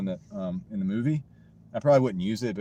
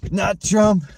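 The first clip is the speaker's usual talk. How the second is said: mock viewer groan; 8 semitones higher, 9 dB louder, much slower than usual. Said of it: "they're like".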